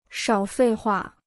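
slow, clipped-off dismissal; a measured, no-nonsense shutdown; curt and decisive. A narrator's reading. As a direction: cut him off flatly and firmly. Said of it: "说的斩钉截铁".

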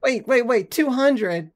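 loud, high, incredulous question; loud, high-pitched double take; startled disbelief. Real, not acted. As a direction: burst in, thrown by the number. Shocked at his death count.

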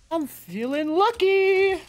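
high, sing-song hope; hopeful sing-song. Real, not acted. Streamer opening a case, high sing-song hope. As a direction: chirp it up like a lucky charm.

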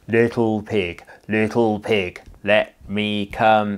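loud, slow wolf call. Acted. Wolf calling to the first pig, loud and slow. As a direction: drag it out like a wolf at the door. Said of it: "and he said".

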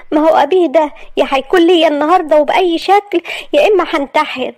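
put-on high, desperate girlish voice; lovesick young girl. Maria confessing to her friend Malak, high and desperate.

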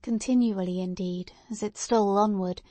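usual speaking voice

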